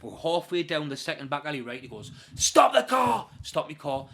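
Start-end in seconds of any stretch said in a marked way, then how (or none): 2.4-3.3 high, loud sudden command; shouted order. Retold or imitated. Intruder halting the driver in a back alley, shouting. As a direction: shout it like a hijacker mid-journey.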